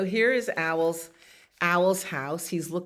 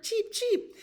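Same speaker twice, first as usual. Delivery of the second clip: very high little cheeps; chirping sparrow. A narrator's reading. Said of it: "The sparrows chirped".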